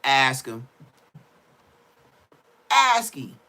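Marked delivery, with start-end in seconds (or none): 2.7-3.4 loud, high-pitched insistence; emphatic repeat. Real, not acted. Telling viewers to check the story, emphatic. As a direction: punch it out higher and louder.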